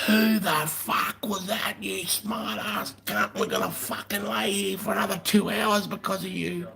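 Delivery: very high, slow, whispered furious officer; imitating an angry lieutenant. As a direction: hiss the officer's rage down the line. Lieutenant angry over the phone.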